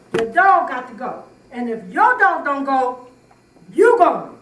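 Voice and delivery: angry ultimatum. Real, not acted. Heated argument about the dog.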